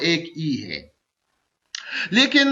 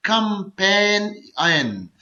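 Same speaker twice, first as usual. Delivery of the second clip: Companion very slowly, कं-पेन-इयन; syllable by syllable; much slower than usual. Shown as a demonstration. Teacher demonstrating a three-syllable word, companion slowly split in three. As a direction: break companion into three drawn-out beats. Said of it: "ये three syllable word है".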